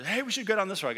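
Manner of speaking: mock eager invitation; upbeat tempting suggestion. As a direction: sell the bad idea brightly. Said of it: "People go".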